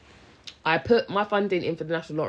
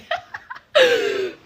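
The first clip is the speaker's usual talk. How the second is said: a real flustered giggle; high shy laugh; 7 dB louder than usual. Real, not acted. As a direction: burst of high, loud embarrassed laughter. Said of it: "I get shy".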